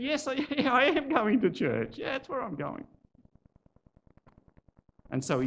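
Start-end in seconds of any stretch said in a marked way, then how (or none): 0.0-2.9 slightly hammed-up, high-pitched hesitant yes; decide on the spot, stumbling with a little laugh; higher-pitched, faltering agreement; hesitant acceptance. Man hesitantly accepts the invitation.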